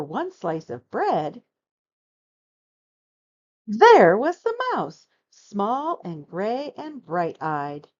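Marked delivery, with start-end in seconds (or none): 3.7-5.1 surprised reveal. A narrator's reading.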